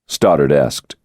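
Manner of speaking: normal talking voice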